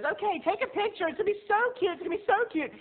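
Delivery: high, excited cooing; gushing excitement. Retold or imitated. Friend eagerly grabbing her camera, high and gushing. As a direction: coo and insist it will be cute. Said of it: "she goes".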